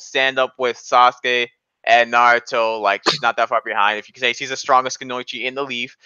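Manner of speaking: ordinary talk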